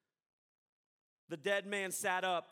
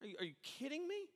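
incredulous; 6 semitones higher, 6 dB quieter, faster than usual. Acted. Pastor acting out disbelief.

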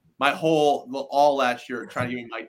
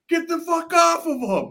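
retold shout; 4 dB louder than usual. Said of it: "He's saying".

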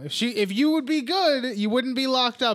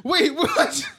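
high, laughing double take; puzzled, half laughing; confused surprise; 8 semitones higher, 4 dB louder than usual. Retold or imitated. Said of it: "he's like".

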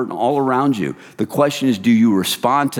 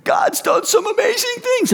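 very high, squealing excitement; excited shouted news. Acted. Husband walking in at night to his wife, excited shout. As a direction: burst out with it in a high, overjoyed shout. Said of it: "Do you walk in at night with your wife and go".

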